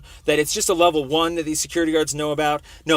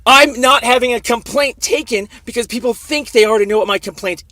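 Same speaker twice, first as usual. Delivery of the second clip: heated; 6 semitones higher, 4 dB louder than usual. Real, not acted. Still heated after outburst.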